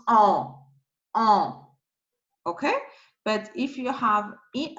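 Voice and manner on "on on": French nasal "on", no N sounded; isolated nasal "on", demonstrated clearly; nasal vowel. Shown as a demonstration. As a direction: say "on" as one vowel through the nose. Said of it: "the nasal vowels".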